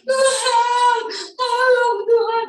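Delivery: put-on loud, very high, drawn-out childish wail; mock whining. Preacher mocking believers who keep begging God, exaggerated whining. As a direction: whine and beg like a nagging child, stretched out. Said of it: "Masih merengek-rengek?".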